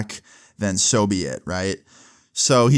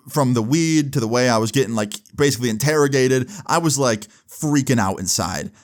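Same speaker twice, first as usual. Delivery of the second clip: usual speaking voice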